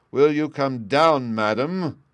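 slow, loud, high enraged demand; greatest rage. A narrator's reading. Bluebeard demands his wife come down, in rage. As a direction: roar it out, drawn out in fury. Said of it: "said Bluebeard in the greatest rage".

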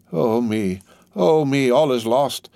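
high wail of despair, narrated; distressed cry. A narrator's reading. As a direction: cry out as if all is ruined. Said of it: "cried she, wringing her hands".

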